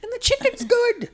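high-pitched giggling through the words; words squeaked out between laughs; laughing. Real, not acted. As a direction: laugh helplessly while admitting it. Laughing at own love of kid place.

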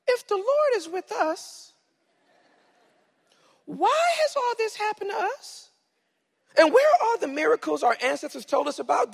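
put-on high-pitched southern-accented character voice. Gideon questioning the Lord, high southern-accented protest. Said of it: "I gave him a southern accent".

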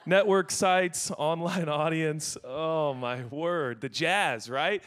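ordinary talk

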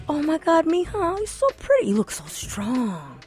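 high, soft, doting grandma voice; a grandmother's tender praise; grandma praising. Retold or imitated. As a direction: gush like a proud abuela.

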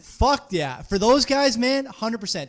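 pumped-up stock bro cheering; crypto bro. Real, not acted. Hyped agreement about diamond hands.

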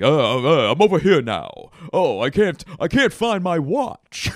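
loud, high New Yorker fussing; New York accent. Shown as a demonstration. New Yorker who can't find their watch, loud New York accent. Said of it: "it's just stuff like".

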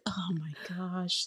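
low, slow, near-whispered mock dismay; mock exasperation. Imitating passengers stuck behind a woman deboarding, mock exasperated. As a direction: grumble it slowly under your breath. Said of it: "everyone's like".

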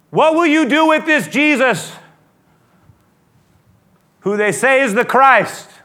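high, shouted, ringing question; loud challenge. Acted. Pilate putting the question in Matthew, loud high challenge. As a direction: cry it out to everyone listening.

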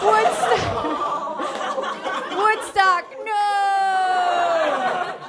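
high anguished cry of the name; high, pleading mock shout; cried. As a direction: cry out high as the bird drops. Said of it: "cried Charlie Brown".

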